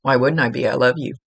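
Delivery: ordinary delivery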